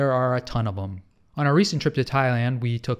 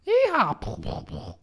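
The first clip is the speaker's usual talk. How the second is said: cowboy yeehaw; 7 semitones higher than usual. Acted. Callback cowboy whoop.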